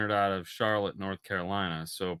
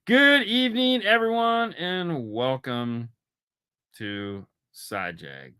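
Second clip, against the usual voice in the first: host intro; 5 semitones higher, slower than usual. Acted. Opening the show as host.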